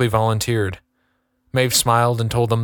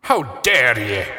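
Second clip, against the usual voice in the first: blustering captain; slower than usual. Acted. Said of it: "blustered the captain".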